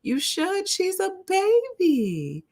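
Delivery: very high, drawn-out wail of disbelief; high-pitched exasperated plea; appalled inner protest. Retold or imitated. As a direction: stretch it out slow and high, appalled. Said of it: "I'm thinking in my mind".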